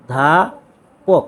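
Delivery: অধ্যাপক drawn out very slowly, split into অ and ধ্যাপক; syllable by syllable. Shown as a demonstration. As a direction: spell out অধ্যাপক slowly in two parts, low. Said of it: "এই উচ্চারণটাকে তুমি দুইটা পাঠ করবা".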